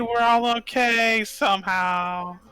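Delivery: high, loud, drawn-out call; long-distance shout to companions; shouting back. Acted. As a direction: holler it slowly to someone far off. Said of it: "shout back".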